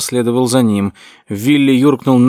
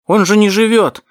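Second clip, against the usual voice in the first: high, loud desperate cry; shouting; 9 semitones higher, 3 dB louder than usual. A narrator's reading. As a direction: cry out against it. Willy shouts protest.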